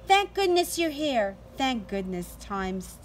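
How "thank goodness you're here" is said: ordinary delivery, nothing marked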